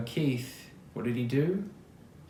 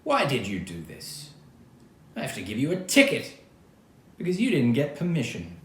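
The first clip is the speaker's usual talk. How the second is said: a policeman scolding, rising louder; stern lecture; 3 semitones higher, 6 dB louder than usual. A narrator's reading. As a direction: scold firmly like a cop writing a ticket. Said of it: "lectured Keith".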